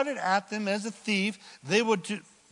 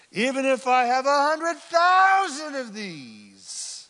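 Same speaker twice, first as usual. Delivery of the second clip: a hint of a whiny inner voice, louder and higher; half-hearted self-pity voice; mock inner voice; 7 semitones higher, 9 dB louder, slower than usual. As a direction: lightly play the sulking self. Voicing the inner complaint.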